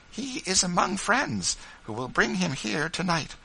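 high, earnest woman's voice; motherly reassurance. A narrator's reading. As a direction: reassure her that help is coming. Rachel reassures Eliza.

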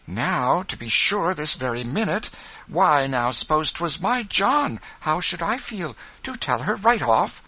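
high, loud, excited plea; excited little Quaker woman, high-pitched; eager urging. A narrator's reading. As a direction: urge them to tell right away. Ruth urges telling at once.

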